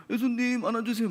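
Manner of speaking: put-on high, whiny little child begging to be held; mock child plea. Child with dirty hands begs Jesus for a hug, high mock whine. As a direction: play a grubby kid reaching up to Jesus, pitched high. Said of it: "더러운 손으로 예수님 막 이렇게".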